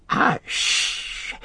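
high, voiceless creature hush; urgent long shushing hiss; Gollum hushing. Acted.

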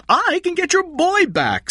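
high, loud crook shout. A narrator's reading. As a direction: shout it after him, high. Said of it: "Score shouted".

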